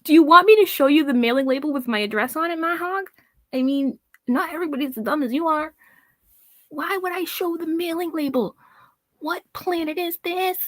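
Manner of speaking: scoffing in disbelief; incredulous. Real, not acted. Incredulous rant at the hog.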